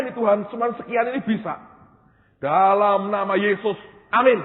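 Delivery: high, loud, pleading prayer to God; high-pitched fervent praying aloud; loud retold prayer. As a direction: cry out to God, half joking. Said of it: "Saya angkat di depan Tuhan".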